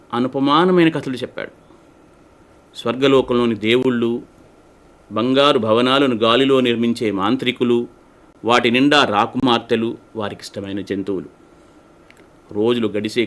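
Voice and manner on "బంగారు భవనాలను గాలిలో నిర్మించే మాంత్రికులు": ordinary delivery, nothing marked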